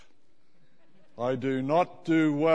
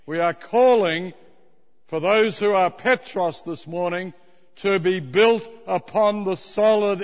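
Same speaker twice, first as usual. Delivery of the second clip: preaching; 5 semitones higher, 8 dB louder than usual. Acted. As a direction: preach every word like scripture. Preaching climax to congregation.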